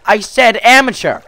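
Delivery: high, loud acted protest; mock-outraged retort; indignant correction. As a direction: snap back as the offended friend. Voicing Bob correcting him.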